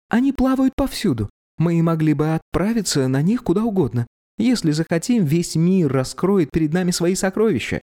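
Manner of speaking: put-on high, eager girl's voice; young woman voice. A narrator's reading. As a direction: lift it into a dreamy girl. Young girl voiced by narrator.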